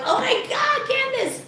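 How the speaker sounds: squeaky high mock cry of oh my God Candace; mock exasperation. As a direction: play the overwhelmed fans, way up high. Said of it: "You guys make me feel like".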